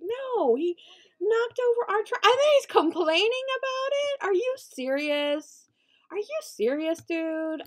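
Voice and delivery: high, loud indignant outburst; outraged disbelief; incredulous. Real, not acted. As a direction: protest as if you cannot believe the nerve. Visitor complains about own mess.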